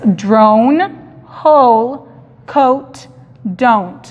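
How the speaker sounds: "drone" "hole" "coat" "don't" con diptongo ou claro; teacher model words. Shown as a demonstration. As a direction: modela el diptongo ou en cada palabra. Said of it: "tenemos un sonido de diptongo". (maestra modelando el diptongo)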